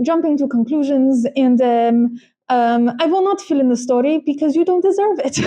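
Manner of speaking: playful, badly faked Russian accent; mock Russian accent. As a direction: quote the driver in a put-on Russian accent. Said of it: "I don't know why he's Russian".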